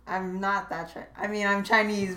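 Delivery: ordinary delivery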